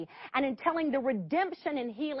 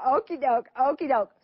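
nervous okie doke; 4 dB louder than usual. Retold or imitated. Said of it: "I'm thinking".

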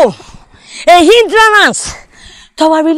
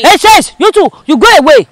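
usual speaking voice

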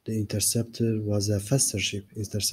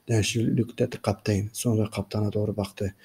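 ordinary delivery

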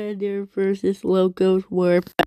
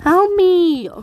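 high shout; 7 semitones higher, slower than usual. Real, not acted. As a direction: yell for help. Yelling at game character.

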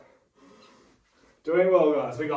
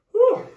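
relieved whew; 8 dB louder than usual. Real, not acted. Relief after hard set.

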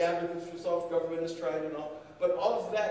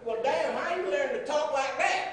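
amazed soldier; 8 semitones higher than usual. Retold or imitated. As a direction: marvel like an impressed soldier. Soldier amazed at his German.

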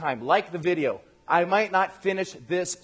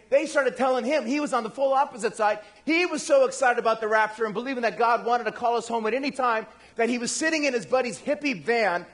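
ordinary talk

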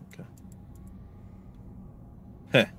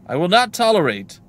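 raised, loud protest; indignant cleric; 6 semitones higher, 11 dB louder than usual. Acted. Jailed cleric protesting to a guard, loud indignation. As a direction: refuse firmly, projecting.

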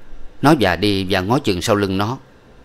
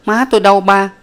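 put-on much higher, louder little boy's question; small child asking; 11 semitones higher, 6 dB louder than usual. A narrator's reading. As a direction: ask like a sleepy small child. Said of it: "liền hỏi rằng".

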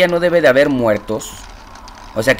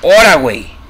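startled yelp; 2 semitones higher, 6 dB louder than usual. Real, not acted. Startled by a horror game jump.

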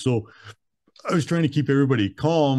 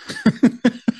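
high laugh; 4 dB louder than usual. Real, not acted. Laughs at own defiance.

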